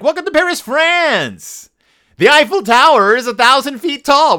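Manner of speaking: high-pitched mock tour guide; Doctor Bear. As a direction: announce it in a high, hammed-up voice. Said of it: "Doctor Bear is like".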